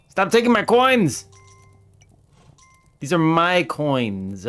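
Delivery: slow, high mock-furious shout at a coin thief; outraged yell. Real, not acted. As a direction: stretch out a furious complaint at a thief. Yelling at greedy NPC.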